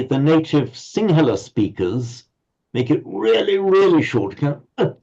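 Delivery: usual speaking voice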